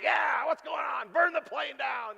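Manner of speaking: mock rant. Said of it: "I'm like".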